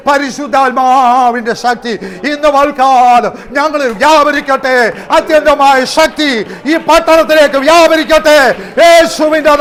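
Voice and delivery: loud, high Pentecostal prayer with tongues; ecstatic prayer. Acted. Preacher praying over the town in tongues, loud. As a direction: pray it out in full fervour.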